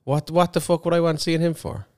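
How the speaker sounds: normal talking voice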